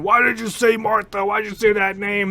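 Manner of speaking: hammed-up angry question; mock angry Batman. Retelling Batman's line again.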